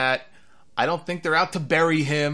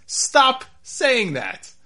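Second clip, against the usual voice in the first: higher, louder, very slow groan of annoyance; exasperated me; 9 semitones higher, 5 dB louder, much slower than usual. Retold or imitated. A fan watching the show, pleading higher and slower, worn out. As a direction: beg them to stop, drawn out and fed up. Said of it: "That was me as I watched this".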